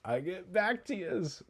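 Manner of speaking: high, soft, drawn-out mock echo; imitation rising high and stretched out; mafia cowboy echo. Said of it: "like, mafia cowboy guy that Bevilacqua plays".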